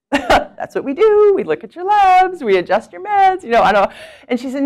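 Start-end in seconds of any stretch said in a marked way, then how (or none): 0.1-3.4 puzzled doctor. Retold or imitated. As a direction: ham up the baffled physician through a laugh. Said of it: "And he's like".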